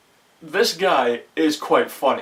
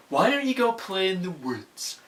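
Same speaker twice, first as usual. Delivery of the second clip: mock sweet mother; 5 semitones higher, 4 dB quieter than usual. Mother voiced in movie recap.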